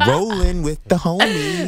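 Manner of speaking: hammed-up Valley Girl; Valley Girl accent. Asked for a California voice, hammed-up Valley Girl. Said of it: "a Clueless line".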